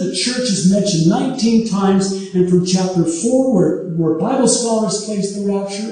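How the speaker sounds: ordinary delivery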